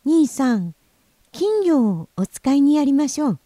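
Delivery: high, loud little-brother voice; voiced young boy, high and forceful; younger brother. 弟の次郎さん.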